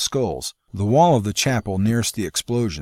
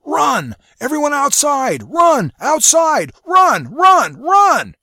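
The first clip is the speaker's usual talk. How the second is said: frantic high shout to flee; 9 dB louder, slower than usual. A narrator's reading. Carl warning everyone to flee, full-throated shout. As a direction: yell at the top of your lungs. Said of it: "He shouted at the top of his lungs".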